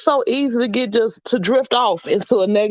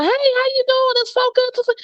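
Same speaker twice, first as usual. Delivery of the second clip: much higher, sing-song welcome; cheery greeting. Retold or imitated. Greeting people at work, high sing-song welcome. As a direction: play the welcoming committee. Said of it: "I'm the one".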